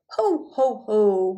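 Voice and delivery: a hearty jolly polar bear laugh; jolly roar. A narrator's reading. Polar bear greeting the gentlemen, jolly roaring laugh. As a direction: ho ho it out big and jolly. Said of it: "roared Mister Whitewash in his jolly voice".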